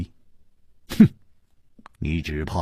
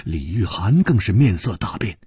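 ordinary delivery, nothing marked

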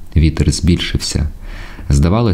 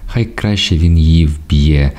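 ordinary delivery, nothing marked

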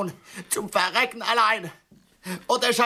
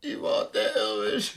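quiet echo; 5 dB quieter than usual. Acted. Yvonne repeats her name, fading into a soft murmur. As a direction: let the name sink away in a murmur. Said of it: "nicht ganz so pathetisch".

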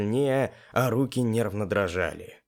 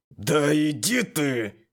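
slow, higher-pitched irritated retort; barked retort; 4 semitones higher, slower than usual. A narrator's reading. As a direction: bark it back, drawing the words out. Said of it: "гаркнул я ему в ответ". (гаркнув в ответ)

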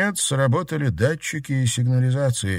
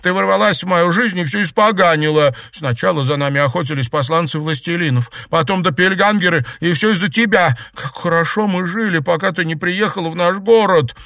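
high-pitched, raised-voice outburst; furious tirade; 7 semitones higher, 5 dB louder than usual. Acted. Betrayed girl blaming her former friend, furious tirade. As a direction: pour out bitter blame at full heat.

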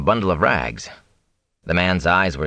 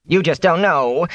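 high, drawn-out whine; whining; 9 semitones higher, 3 dB louder than usual. A narrator's reading. Fane complains that Rand cannot understand, whining. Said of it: "Fane whined".

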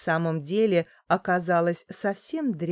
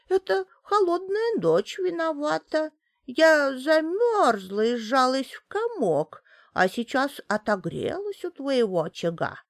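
put-on old woman voice; 7 semitones higher than usual. A narrator's reading. Old woman excuses herself.

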